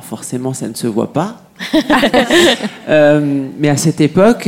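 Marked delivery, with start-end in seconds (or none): 1.6-2.8 short high falling burst of real laughter; a quick amused laugh. Real, not acted. Speaker laughing at her own joke, high laugh.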